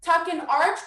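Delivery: ordinary talk, nothing marked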